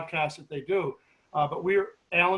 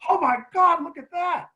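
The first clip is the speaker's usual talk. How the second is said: much higher, louder at first, then dropping back; astonished cry; 11 semitones higher, 5 dB louder than usual. Retold or imitated. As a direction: point at it in shock. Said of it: "Denise goes".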